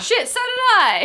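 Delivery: high, slow startled outburst; surprised. Real, not acted. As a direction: caught off guard and swearing. Surprised they got the same result.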